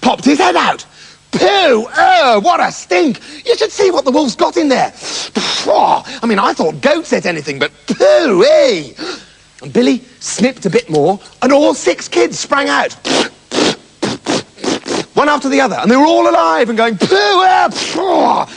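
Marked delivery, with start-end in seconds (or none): 1.3-8.9 put-on a goat kid holding its nose; disgusted eldest kid. Eldest kid pops out, stinks. 17.0-18.5 hammed-up yelling of the kids; kids crying pooh. Kids all cry out.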